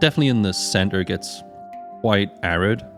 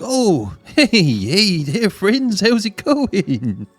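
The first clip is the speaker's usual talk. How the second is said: friendly hunter voice; 8 semitones higher, much slower than usual. Acted.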